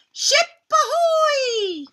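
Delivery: very high, loud, slow call from the crow's nest; shouted. Acted. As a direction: yell it from the mast. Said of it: "Pirate Violet shouted".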